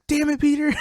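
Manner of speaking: high, loud cursing at a prankster; hammed-up exasperation; mock exasperated yell. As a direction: imitate a duped friend crying out. Acting out friend cursing the prankster.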